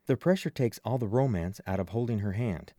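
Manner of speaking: usual speaking voice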